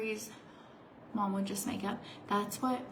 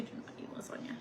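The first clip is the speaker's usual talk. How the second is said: fast unvoiced whisper; 9 dB quieter, faster than usual. Real, not acted. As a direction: whisper it quickly.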